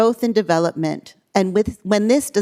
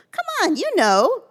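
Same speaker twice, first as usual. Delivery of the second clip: higher, disbelieving come on; can't believe the child doesn't know; incredulous; 11 semitones higher than usual. Acted. Doctor teasing the child, incredulous and pitched up high.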